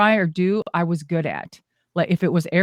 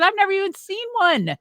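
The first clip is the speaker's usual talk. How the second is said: high, wide-eyed retold protest; excited disbelief; 13 semitones higher than usual. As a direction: act out her own amazed reply. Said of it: "I said".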